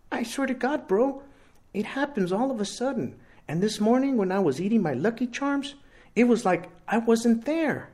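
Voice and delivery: swearing it's true to his best friend; Rooster, earnest teen boy. A narrator's reading.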